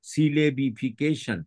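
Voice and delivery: সিলেবিফিকেশন said in separate syllables; teacher dividing সিলেবিফিকেশন into its syllables; syllable-by-syllable pronunciation. Shown as a demonstration. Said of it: "syllable ভাগ করে".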